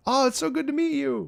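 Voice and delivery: very high-pitched meet-and-greet voice; put-on polite greeting. Streamer greets a fan mid-emergency, high put-on politeness.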